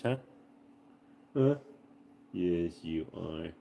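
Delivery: slow soft tender. Real, not acted. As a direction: coo gently to him. Soft talk to sleepy dog.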